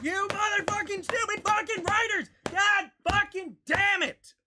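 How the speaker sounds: high, loud furious outburst at the writers; raging shout. Real, not acted. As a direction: explode at whoever wrote this.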